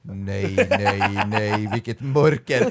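low, slow mock-gloomy "nej"; hammed-up doom; comic despair. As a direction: sink into theatrical dread. His dread contrasted with the other's joy.

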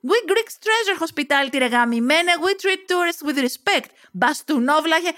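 high-pitched, outraged lecture; furious tirade. Acted. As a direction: hurl it at him with indignant pride. Heroine hurls shoes and insults. (οργισμένος εξάψαλμος με ψηλή φωνή)

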